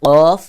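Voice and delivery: কফ hard and rounded from the back of the tongue; hard qof. Shown as a demonstration. Teacher contrasting two letters, কফ hard and round. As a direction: hit কফ firmly as a round "qof". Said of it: "শক্ত করে উচ্চারণ হবে".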